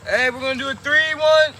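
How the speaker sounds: loud call across the field, pitched high. Real, not acted. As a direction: shout to teammates far away. Calling out to the team.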